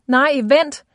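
loud, high desperate plea; shout. A narrator's reading. Alisan crying out to Klahon to wait. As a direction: call out to stop him leaving. Captive cries out to stop him.

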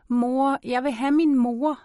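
put-on high, distressed child's cry; acted wail without full volume; child screaming for mother. A narrator's reading. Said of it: "Jeg skriger".

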